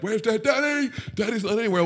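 high, mock-panicked child calling for daddy; a lightly voiced child wondering where daddy went; worried kids. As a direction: imitate the little boys calling out for their dad. Kids voiced looking for dad.

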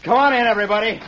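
high, loud call to the next room. Acted. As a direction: summon the hidden listeners. Calls the hidden witnesses in.

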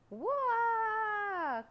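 high, loud, sustained "waaah" of someone sent flying; long wailing cry. Acted. Knight knocked into the air by dragon, long high wail. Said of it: "cried the Turkish knight".

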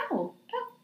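high-pitched, hesitant, awkward reply; polite bemusement. Acted. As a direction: play puzzled but politely sympathetic. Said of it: "where you just kinda cock your head".

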